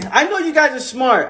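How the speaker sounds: normal talking voice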